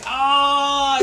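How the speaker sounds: high, loud pained yell; retold scream of pain. Runner on the trail screaming from cramps. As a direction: let out a cramping scream at full lungs. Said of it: "I was screaming".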